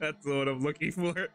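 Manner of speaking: talking through a laugh; barely held-in laughter; amused. Real, not acted. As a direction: laugh while getting the words out. Amused by what he found.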